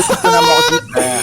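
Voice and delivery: laughing. Real, not acted. Laughs mid-insult in argument.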